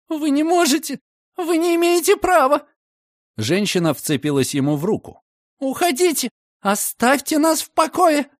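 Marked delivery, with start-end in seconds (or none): 0.1-2.7 very high outraged shriek; shrill protest. A narrator's reading. Landlady shrieking at commissioner, very high and outraged. 5.6-8.3 very high outraged shriek; shrill protest. A narrator's reading. Landlady shrieking at commissioner, very high and outraged. Landlady clutches his arm.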